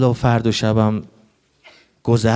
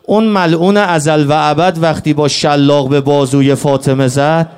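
keen the martyrdom tale, building to a cry; mourning lament; 4 semitones higher, 5 dB louder than usual. Acted. Rowzeh lament, rising to wail.

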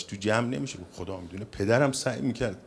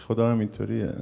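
ordinary talk

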